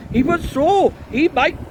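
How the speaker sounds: high, loud interruption; excited high-pitched heckler; heckling priest. A narrator's reading. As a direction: butt in loudly from the crowd.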